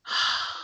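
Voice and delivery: soft, airy sigh; moved sigh. Acted. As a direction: exhale quietly, as if deeply moved. Jaded person moved by newcomer.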